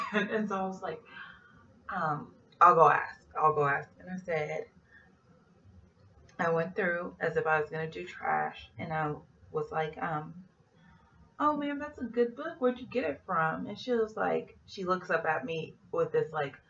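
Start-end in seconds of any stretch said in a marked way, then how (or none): 2.6-3.9 loud, eager offer to go ask. Retold or imitated. Flight attendant eagerly volunteers to ask, loud. 11.4-13.7 high, sugary fake-friendly question; fake sweet. Attendant baits the passenger with fake sweetness, high.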